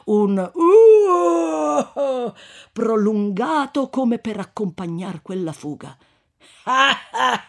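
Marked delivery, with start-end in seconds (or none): 0.5-2.3 long, high, loud victory whoop; triumphant whoop. Acted. Corpse-carriers jeering the fleeing mob, triumphant whoop. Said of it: "s'alzò un urlo di trionfo". 6.6-7.4 loud, high gloating laughter; mocking laugh. Corpse-carrier gloating to Renzo, mocking laugh.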